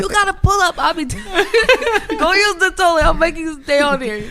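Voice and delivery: high, loud scold broken by laughter; laughing mock scold. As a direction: laugh through telling him to use the toilet. Mom instructing toddler, laughing.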